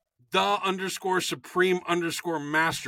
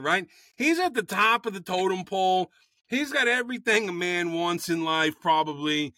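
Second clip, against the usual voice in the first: normal talking voice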